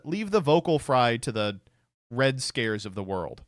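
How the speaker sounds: ordinary talk, nothing marked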